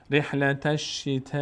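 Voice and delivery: "رحلت الشتاء" with the last aa held very long; madd elongation. Shown as a demonstration. Teacher demonstrating madd to learners, long held vowel. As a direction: stretch the aa of "الشتاء" far beyond natural length. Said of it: "مد یعنی کشیده شدن".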